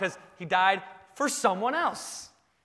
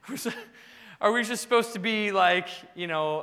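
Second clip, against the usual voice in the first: usual speaking voice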